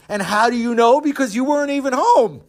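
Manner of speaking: high, loud, challenging comeback; indignant retort. Acted. Visitor challenging the absent host, indignant. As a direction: throw the question back, high and affronted.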